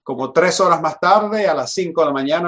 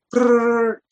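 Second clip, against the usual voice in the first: high rolled-tongue phone trill; sustained, high-pitched ringer noise; imitated phone ring; 7 semitones higher than usual. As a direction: mimic a ringing telephone. Imitates the hotel phone ringing.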